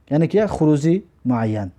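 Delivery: normal talking voice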